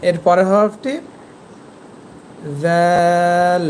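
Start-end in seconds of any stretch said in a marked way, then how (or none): 2.6-3.6 tongue tip at teeth edges, held দাল; thin letter sound. Shown as a demonstration. Teacher showing the thin letter, long lisped দাল. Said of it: "পাতলা হবে".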